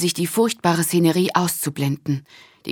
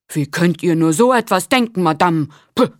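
offended; 5 dB louder than usual. A narrator's reading. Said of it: "Lateris klang beleidigt".

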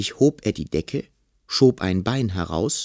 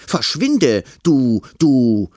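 loud, high outraged shout; calling out; 4 semitones higher, 7 dB louder, slower than usual. A narrator's reading. Boy chasing the monster off, loud high shout. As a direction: shout at an intruder, lost for an insult. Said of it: "rief er".

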